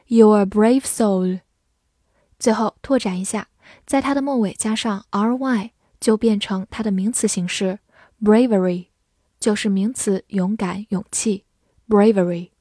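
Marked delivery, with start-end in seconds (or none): none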